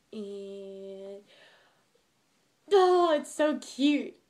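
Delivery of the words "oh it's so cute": loud, high, drawn-out squeal of delight; gushing. Real, not acted. Admiring her hoodie to viewers, loud and gushing.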